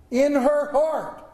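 high, slow, emphatic preacher's cry; impassioned preaching. Acted. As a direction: proclaim it slowly and forcefully, word by word. Preacher cries out the refrain.

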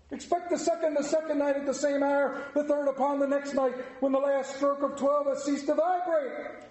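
high, solemn phantom warning; prophesying ghost. Acted. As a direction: proclaim the coming spirits.

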